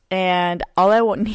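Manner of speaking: normal talking voice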